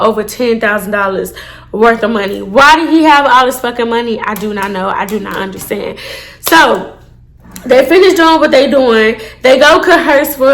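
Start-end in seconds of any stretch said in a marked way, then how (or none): 4.2-6.0 quiet. Real, not acted. Baffled aside, much quieter.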